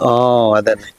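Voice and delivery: ordinary talk, nothing marked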